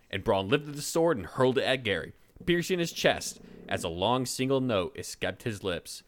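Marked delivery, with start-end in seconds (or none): none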